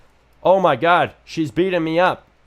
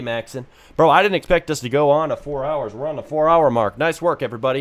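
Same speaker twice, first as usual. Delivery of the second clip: ordinary delivery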